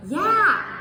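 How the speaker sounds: "yeah" very high and loud, sharp fall; exclamation yeah. Shown as a demonstration. Teacher showing the exclamation tone: high, loud "yeah". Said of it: "your exclamation point".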